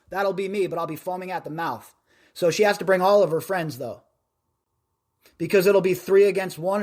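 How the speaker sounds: ordinary talk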